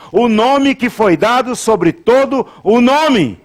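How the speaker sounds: high, slow, drawn-out preaching; solemn high pulpit declaration; impassioned proclamation. Real, not acted. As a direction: proclaim it to the congregation, stretching each phrase.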